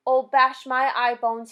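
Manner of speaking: loud, slow distressed outcry; slow loud exasperation; distressed cry. A narrator's reading. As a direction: shout in distress. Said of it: "he cried".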